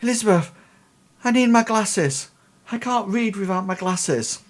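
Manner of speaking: put-on high-pitched old man fussing. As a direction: complain to your daughter as a high-voiced old father. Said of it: "the old man says".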